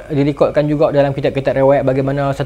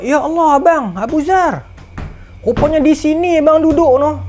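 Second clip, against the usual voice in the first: higher, stunned recognition; surprised cry; 9 semitones higher than usual. Acted. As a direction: gasp out the discovery. Companion finds dying Abu Zar.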